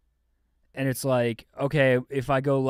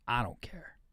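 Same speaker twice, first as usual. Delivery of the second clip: whisper; 11 dB quieter than usual. Acted. Soft sleepy host aside.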